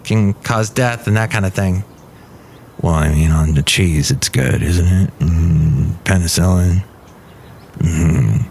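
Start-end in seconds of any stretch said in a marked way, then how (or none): 2.8-8.4 much lower, slow put-on voice; deep character voice. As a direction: sink into a low dopey character. Low John Deere voice again.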